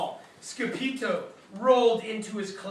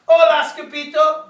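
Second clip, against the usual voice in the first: very loud, higher "Hola"; hearty greeting; 6 semitones higher, 13 dB louder than usual. Acted. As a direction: call out a big hello. Amigo greets loudly.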